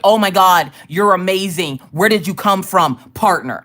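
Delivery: loud, gushing mock amazement; gushing excitement. Twitch welcoming its new partner, gushing loudly. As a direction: act out an over-the-top, starstruck welcome. Acting Twitch's reply to her.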